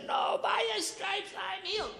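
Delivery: high, desperate declaration of faith; fervent declaration. Retold or imitated. Man in pain rebuking it, high desperate declaration. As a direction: cry it out against the pain. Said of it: "I'm screaming".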